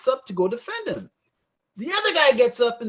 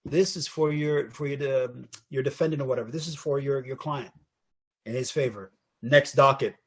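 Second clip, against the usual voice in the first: low, soft, businesslike murmur; calm judge resuming; 7 semitones lower, 5 dB quieter than usual. Retold or imitated. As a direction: drop right down to routine business. Judge back to routine after outburst.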